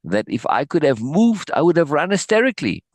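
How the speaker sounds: an earnest, climbing insistence; genuine fervor. A narrator's reading. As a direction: push it out fervently, picking up speed. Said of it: "I said with genuine fervor".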